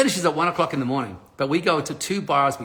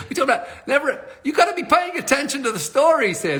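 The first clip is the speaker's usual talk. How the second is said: high-pitched, playful telling-off; mock scolding; 10 semitones higher than usual. Host chiding Dean for not following the stories, mock scolding. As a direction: pretend outrage, pitched high, on the edge of laughter.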